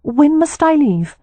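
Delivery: asking as if dreading the answer; resigned young wife. Acted. Poppy resigned.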